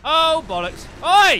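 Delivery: loud startled yell; startled shout. Real, not acted. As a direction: shout out in shock. Sudden attack in game.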